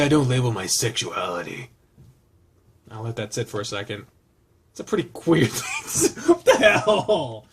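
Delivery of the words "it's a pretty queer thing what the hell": breaking into high laughter mid-line; laughing disbelief. Real, not acted. Reader cracking up at the game's line, laughing. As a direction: crack up while reading, voice pitched up.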